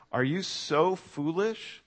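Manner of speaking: slow, drawn-out rebuke rising on "so"; stern rebuke. Retold or imitated. Paul rebuking the Galatians, slow stern question. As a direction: stretch "Are you so foolish?" slowly with a rise on "so". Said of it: "Do you hear the tone here?".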